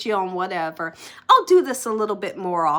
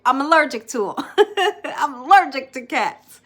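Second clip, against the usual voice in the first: laughing; 5 semitones higher, 6 dB louder than usual. Real, not acted. Laughing about cat allergy.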